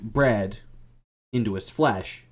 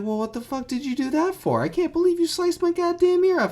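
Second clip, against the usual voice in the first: much higher, louder shrieking outrage; outraged shriek; 4 dB louder than usual. Acted. Guard with a sliced-off ear, shrieking outrage. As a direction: shriek in disbelief at losing an ear.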